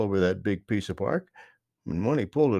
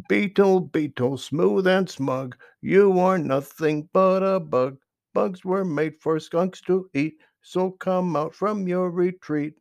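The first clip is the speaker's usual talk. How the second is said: higher, sing-song little chant of a skunk; Jimmy Skunk singsong; 7 semitones higher, 4 dB louder than usual. A narrator's reading. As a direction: tease the beetles out with a sing-song rhyme.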